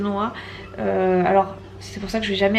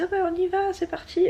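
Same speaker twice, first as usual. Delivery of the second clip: excited quote; 10 semitones higher than usual. Retold or imitated. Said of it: "qu'ils me disent".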